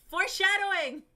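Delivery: loud, high burst of delight; excited exclamation. Real, not acted. Narrator gleefully interrupting own story about a hint. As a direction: crow it out like spotting a twist. Narrator jokes on plot twist.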